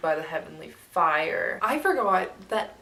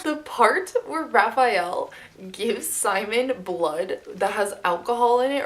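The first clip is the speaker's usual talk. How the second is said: ordinary talk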